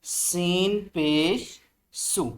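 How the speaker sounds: very slow drilled syllable; slow recitation. Shown as a demonstration. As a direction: spell it out slowly. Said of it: "ज़ोर से बोलेंगे".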